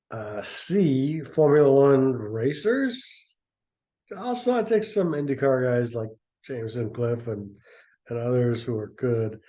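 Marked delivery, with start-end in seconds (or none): none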